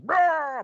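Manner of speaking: un ruggito finto, acuto e forte; hammed-up monster roar, loud and high; werewolf roar. Werewolf pops up from couch.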